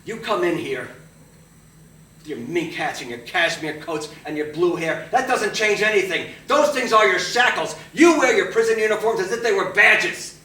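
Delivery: loud, high theatrical declaiming; hammed-up audition shout; booming monologue. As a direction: bellow the speech like a stage tyrant. Acting teacher's monologue piece.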